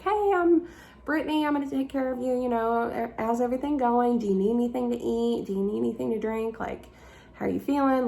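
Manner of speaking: high, chirpy mock nurse greeting; friendly nurse. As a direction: imitate a cheerful nurse checking in.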